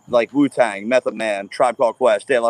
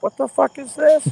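stunned disbelief; 14 semitones higher than usual. Retold or imitated. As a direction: pitch it way up in shocked disbelief. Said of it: "and I'm like".

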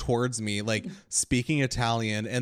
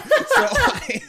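high laughing burst; giggling too hard to go on; 9 dB louder than usual. Real, not acted. Cracking up while telling the car story.

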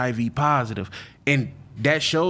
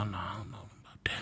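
whispered; 6 semitones lower, 17 dB quieter, faster than usual. Real, not acted. Declining surgery idea.

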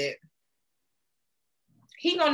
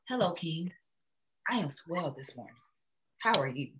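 dreamy soft reply; 5 semitones lower, 7 dB quieter than usual. Pretend. Dreamy reply to Idris Elba.

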